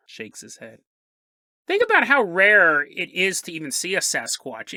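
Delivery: usual speaking voice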